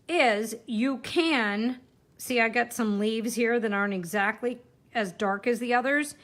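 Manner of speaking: usual speaking voice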